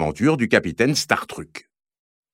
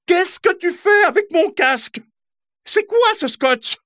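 much higher, piercing furious sister voice; angry big sister. Acted. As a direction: shriek at the boys over the helmet. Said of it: "un cri perçant retentit". (grande sœur furieuse, voix perçante et aiguë)

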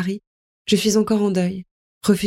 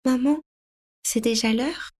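put-on sleepy child; 5 semitones higher, 4 dB quieter than usual. Sleepy child asks mother.

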